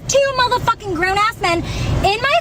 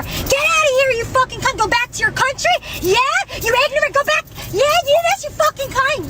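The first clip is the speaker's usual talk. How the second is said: retold yell; 8 semitones higher than usual. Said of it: "Yelling, screaming at me".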